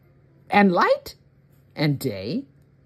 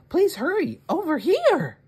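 much higher, eager calling voice; urgent call; 11 semitones higher than usual. Acted. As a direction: call him over in a hurry. Miss May calls the mayor.

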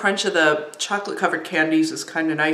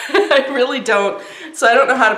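laughing; 5 semitones higher, 5 dB louder than usual. Real, not acted. Laughs at own pretending.

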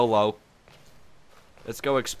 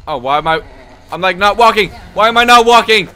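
high, loud rattled talk; shaken, breathless relief; 9 semitones higher, 12 dB louder than usual. Real, not acted. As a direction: still reeling from the scare. Shaken after monster attack.

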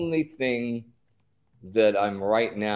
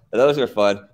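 ordinary talk, nothing marked

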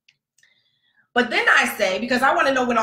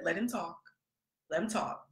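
much softer, calm motherly hush; calm Carol Brady; 3 semitones lower, 14 dB quieter than usual. Retold or imitated. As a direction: hush the boys like a TV mom. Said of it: "I turned into Carol Brady".

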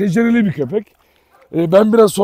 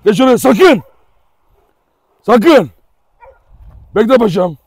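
scolding; 3 semitones higher, 4 dB louder than usual. Real, not acted. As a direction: warn the dog off sharply. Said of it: "Kızıyorum hemen". (kızgın, yüksek sesle uyarı)

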